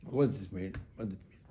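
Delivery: ordinary delivery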